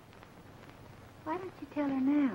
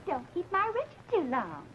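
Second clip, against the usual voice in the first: mocking imitation; 8 semitones higher than usual. Mocks departing rival's words.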